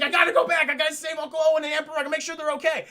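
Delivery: frantic shout. Retold or imitated. Luke insisting, acted shout.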